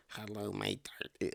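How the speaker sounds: slow, odd-timbred smarmy neckbeard. Acted. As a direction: drag out the creepy hello. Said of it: "do the neckbeard voice".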